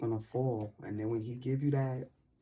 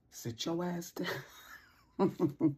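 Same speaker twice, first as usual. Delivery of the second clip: laughing; 6 semitones higher, much slower than usual. Acted. Laughing command.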